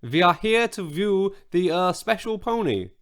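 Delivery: hammed-up phony Russian accent. A narrator's reading. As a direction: play a girl faking a Russian accent. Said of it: "in her best phony Russian accent".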